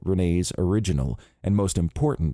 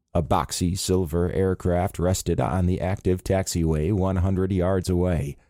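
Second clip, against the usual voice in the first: plain reading voice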